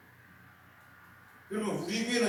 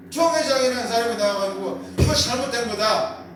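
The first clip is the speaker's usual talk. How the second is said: loud, indignant preaching; full-force preaching; 3 semitones higher, 6 dB louder than usual. Acted. Preacher denounces denomination head to congregation, shouting. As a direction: pastor rails against church leaders, loud and indignant.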